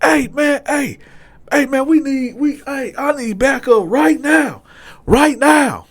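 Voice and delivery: high, loud, urgent call for backup; a deputy on the walkie-talkie in a panic; urgent radio call. Retold or imitated. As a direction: demand help right now.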